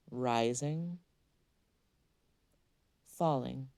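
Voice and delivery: usual speaking voice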